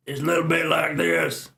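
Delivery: gruff mock Irish accent; jokey Irish brogue with a gruff voice. Shown as a demonstration. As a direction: put on a rough Irish voice for a joke. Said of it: "My Irish one".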